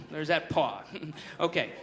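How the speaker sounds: usual speaking voice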